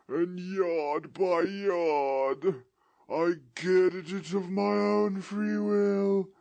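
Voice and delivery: slow, high, loud mournful wail; ghost's voice. Acted. Ghost lamenting its fate to Scrooge in a slow wail. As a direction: lament your doom from the grave.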